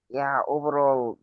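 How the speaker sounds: ordinary talk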